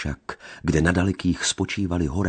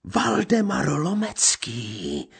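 shrill, very high crazed voice, louder; crazed beggar woman crying from the tree; mad woman's cry; 6 dB louder, much slower than usual. A narrator's reading. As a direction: screech it from above like a madwoman. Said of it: "zvolal nad nimi hlas".

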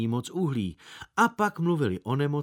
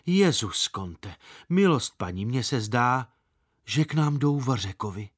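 ordinary delivery

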